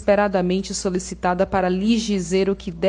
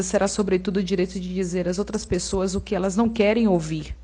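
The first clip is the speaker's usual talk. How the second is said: ordinary talk, nothing marked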